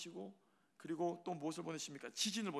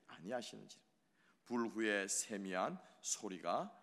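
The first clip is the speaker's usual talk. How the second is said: slow, measured reading aloud; scripture reading; 3 semitones lower, 4 dB louder, slower than usual. Acted. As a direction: read the verses slowly together. Congregation reads scripture together.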